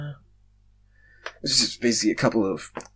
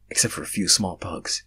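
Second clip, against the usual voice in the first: plain reading voice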